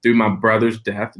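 normal talking voice

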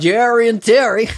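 high, drawn-out mock Irish accent. Co-host echoing the rhyming names, mock Irish accent. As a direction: echo the names in hammed-up Irish, slowly.

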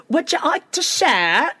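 high mock Cockney, rising at the end; off-brand Cockney. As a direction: imitate a bad British accent. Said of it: "off-brand Cockney".

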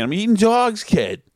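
lightly imitated Boston girlfriend, starting high and dropping; thick Boston accent. Girlfriend answering what she is doing, imitated thick Boston accent. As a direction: imitate a thick Boston accent, pitch jumping then falling. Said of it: "had a totally thick accent".

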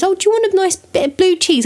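high, lightly voiced sweet mum; mum offering cheese. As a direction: tempt her kindly in a high singsong. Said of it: "She goes".

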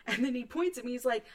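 ordinary talk

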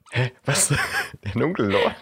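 „Der dunkle Lord?“ verdutzt nachgefragt, steigend; ungläubig und perplex nachhaken; verwirrt nachgefragt. Retold or imitated. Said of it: "Nicht so". (verwirrte Nachfrage)

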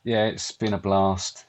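quiet, drawn-out sarcastic deadpan; deadpan fake enthusiasm. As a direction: claim delight while sounding thoroughly underwhelmed. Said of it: "you sound so overjoyed".

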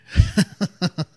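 high-pitched burst of laughter. Real, not acted. Speaker reacting to how well she knows him, real laughter. Laughs after retelling her insight.

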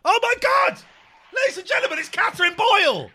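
high, loud thrilled shout; excited announcement. Real, not acted. Welcoming Catherine Boyle back onto the show, shrieking with delight. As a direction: announce her like a star arriving.

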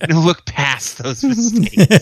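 slow, loud sermonizing; hammed-up parental lecture; mock preachy lecture. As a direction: preach it to the kids.

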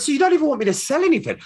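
high, fast incredulous question, a touch hammed-up; mock disbelief. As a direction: act amazed that there's nothing to sell. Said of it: "I went".

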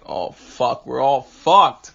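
hammed-up despair; mock dread. His real-life reaction retold.

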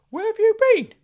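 high, loud eager uncle; Uncle Claude calling out. Acted. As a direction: squeal his name like a giddy fussing uncle. Uncle Claude voiced by narrator.

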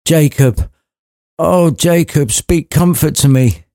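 put-on high, desperate old miser's plea; begging Scrooge. Scrooge begging Marley for comfort, high desperate voice. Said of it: "begged Marley for answers".

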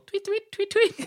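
high-pitched playful tweet tweet; tweeting bird. Pretend. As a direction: chirp like a little bird on the table. Leaves the imaginary bird.

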